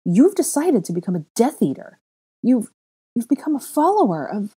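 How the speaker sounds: loud high accusation; accusing. A narrator's reading. Girl accusing the boy of following evil, loud high. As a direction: falter and cut yourself off.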